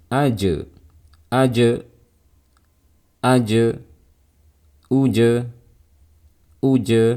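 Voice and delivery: "Aje, uje" with the jim bouncing off in a short neutral release; qalqalah bounce. Shown as a demonstration. Teacher showing students the bouncing sukun jim, qalqalah in "aje, uje". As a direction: let the j in "aje" and "uje" rebound crisply. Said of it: "Suaranya memantul saat dalam keadaan sukun".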